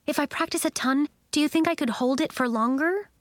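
high, quick pupil voice; eager Nori, hopeful. A narrator's reading. Nori asks her teachers about practicing, eager and hopeful.

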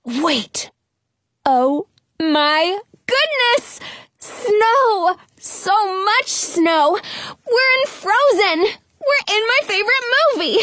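high, squealing excitement; bursting with fan joy; thrilled squeal. Acted. Realizes they're in Frozen.

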